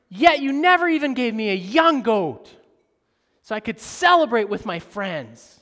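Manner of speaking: loud, high resentful outburst at father; angry complaint. Retold or imitated. Older brother confronting his father, loud angry complaint. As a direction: shout it like years of grievance. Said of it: "he says".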